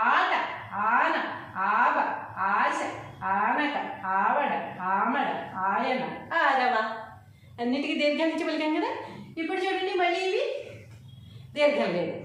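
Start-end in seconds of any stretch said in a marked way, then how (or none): 0.1-6.8 stretched ఆ opening each word, ఆమడ, ఆయన, ఆరవ; long vowels. Shown as a demonstration. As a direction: hold the first ఆ long in ఆట, ఆన, ఆబ. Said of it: "లాంగ్గా పలుకుతున్నాం కదా".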